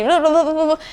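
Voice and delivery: high-pitched nonsense babble; mock rapid babble. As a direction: imitate someone rattling on without a break. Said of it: "A veces queremos hablar súper rápido". (parloteo atropellado y agudo, en broma)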